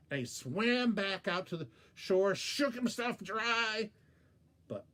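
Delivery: ordinary delivery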